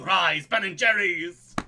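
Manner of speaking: slow, hammed-up villain summons; a joke villain calling up ice cream; mock villain cry. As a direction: drag it out like a pompous villain. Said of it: "imagine if he was going".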